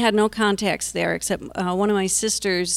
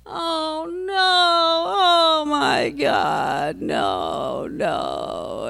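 drawn-out cry, falling away in despair; imitated screaming; 9 semitones higher, much slower than usual. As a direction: act out a daughter's horrified screams. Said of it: "She's just screaming".

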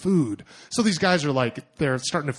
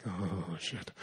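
muttered oh shit, dropped far softer and lower; dread whisper; 7 semitones lower, 13 dB quieter than usual. Retold or imitated. Tourist facing a semicircle of glaring men, dread whisper. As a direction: sink into a hushed, doomed mutter.

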